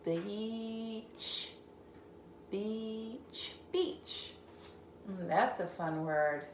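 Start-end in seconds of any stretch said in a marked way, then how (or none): 0.1-3.5 softer slow "beach"; soft sounding out. Shown as a demonstration. Teacher sounding out "beach" for the child. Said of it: "C H at the end". 3.7-4.3 "beach" said quickly and much higher; bright quick read. Shown as a demonstration. Teacher reading "beach" quickly to the child. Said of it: "C H at the end".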